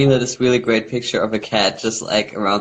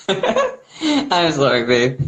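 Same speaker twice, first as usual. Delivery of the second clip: laughing; 6 semitones higher, much slower than usual. Real, not acted. Apologizing while laughing.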